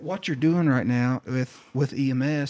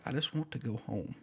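small, much softer voice; retold weary plea; 11 dB quieter than usual. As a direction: drop to a quiet, defeated murmur. Shaken nurse's wish, retold.

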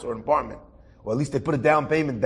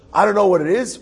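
higher, louder retold protest; exasperated retelling; 6 semitones higher, 6 dB louder than usual. Upset son answering the rabbi's questions, exasperated. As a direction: voice an upset son's frustrated outburst, higher and louder.

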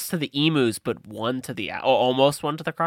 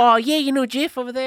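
put-on nonchalant Australian accent; 8 semitones higher than usual. Host mocks colonists gossiping about a death, nonchalant Australian accent. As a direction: toss it off like a laid-back Aussie. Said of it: "speaking in the Australian accent, like, very nonchalantly".

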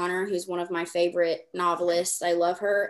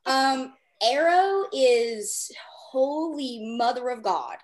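very slow, stretched and higher; mock exasperated drawl; 3 semitones higher, 3 dB louder, slower than usual. Real, not acted. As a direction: drag it out in playful disbelief.

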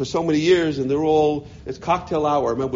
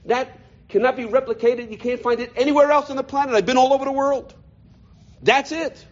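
ordinary talk, nothing marked

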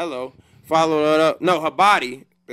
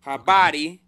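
"Habari" louder in a Swahili accent, lifting at the end; 6 dB louder than usual. Shown as a demonstration. Friend coaching the group on "Habari", strong Swahili accent. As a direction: say "Habari" like a Swahili native, with force. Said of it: "You gotta say it like you from there".

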